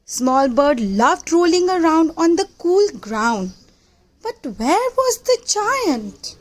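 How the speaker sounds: plain reading voice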